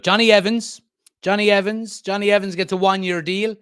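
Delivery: high, loud name call; rousing announcement. Real, not acted. Streamer announcing Johnny Evans's new deal to viewers, rousing. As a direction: hail the player loudly like big news. Announces the next topic with gusto.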